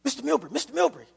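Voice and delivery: lightly voiced driver waking the boss. Driver calling his sleeping boss awake, fast and urgent. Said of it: "I hear this voice break through".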